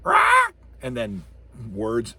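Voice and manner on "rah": high loud cawing rah; loud high-pitched raven screech. Shown as a demonstration. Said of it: "Do you have the Raven go".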